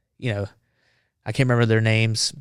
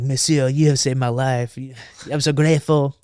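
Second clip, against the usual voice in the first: bad French accent; 3 semitones higher than usual. French reporter thanking the Marine who saved him, bad French accent. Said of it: "if it's a bad French accent".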